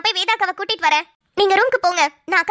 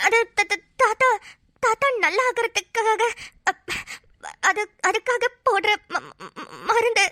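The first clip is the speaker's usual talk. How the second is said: halting, broken words with long gaps; nervous stammer; slower than usual. Acted. Caught at the grandfather's drip, stammering an excuse. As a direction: stumble and repeat syllables while caught out.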